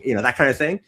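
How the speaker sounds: usual speaking voice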